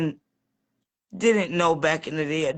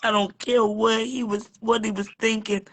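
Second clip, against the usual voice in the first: ordinary delivery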